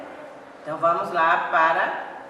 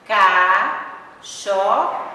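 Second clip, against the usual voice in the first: "Cachorro" spoken very slowly and loudly, syllable by syllable; teacher dictating "Cachorro" in slow loud syllables; 2 semitones higher, 8 dB louder, much slower than usual. Shown as a demonstration. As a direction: stretch "Cachorro" out syllable by syllable for the class. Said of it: "Pronuncia devagar, pausadamente".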